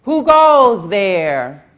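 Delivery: loud, slow challenge from the wall; guard calling out. A narrator's reading. Guard at the gate calls out to the stranger, loud challenge. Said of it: "the guard called out".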